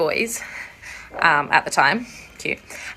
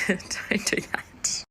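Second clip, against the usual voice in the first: laughing whisper; 10 dB quieter, much slower than usual. Real, not acted. Laughing off embarrassing story.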